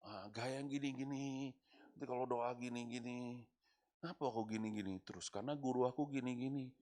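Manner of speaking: lightly voiced young pastor parroting his teacher; imitating young pastor. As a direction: mumble it like a copycat pastor. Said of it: "niru pendeta tua".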